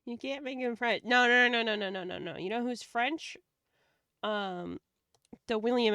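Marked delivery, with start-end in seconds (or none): 1.0-2.4 loud string of no. Real, not acted. Protesting French Owlbear.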